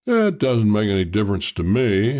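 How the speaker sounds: plain reading voice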